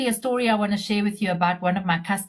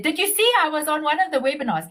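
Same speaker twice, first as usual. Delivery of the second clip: put-on higher, quick, light Polish accent; imitated Polish accent; 6 semitones higher, faster than usual. Friend phoning after a webinar, light Polish accent. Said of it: "in her Polish accent".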